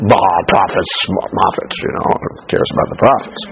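low, trailing grumble, waving it away; dismissive scorn. Pretend. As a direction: play a king brushing it off. Imagines king scorning the prophets.

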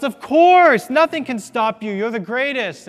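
very high, loud crowd yell; crowd shouts. Retold or imitated. Crowd shouting back at Blondin, very high and loud. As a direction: scream your certainty like a frenzied crowd. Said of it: "And the crowd shouts".

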